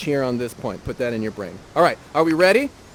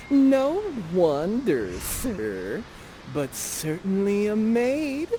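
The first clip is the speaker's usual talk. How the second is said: higher, slow, shy maiden reply; coy Miranda; 7 semitones higher, much slower than usual. Acted. Miranda answering Ferdinand, higher and slow. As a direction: tease him sweetly.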